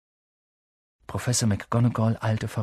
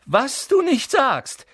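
high, loud, drawn-out mockery; taunting comeback; sneering retort; 13 semitones higher, 6 dB louder, slower than usual. A narrator's reading. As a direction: drag the words out scornfully.

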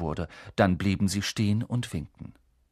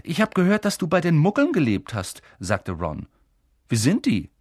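put-on eager boy Ron; 6 semitones higher, 6 dB louder than usual. Ron asking Harry.

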